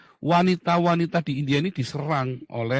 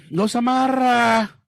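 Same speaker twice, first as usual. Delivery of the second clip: drawn-out taunt; 7 semitones higher, 5 dB louder, slower than usual. Real, not acted. Taunting listeners.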